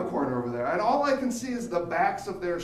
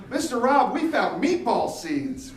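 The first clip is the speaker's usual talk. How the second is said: put-on higher, louder eager kid voice; excited kid; 5 semitones higher, 6 dB louder, slower than usual. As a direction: report it like a thrilled kid. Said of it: "Real excited".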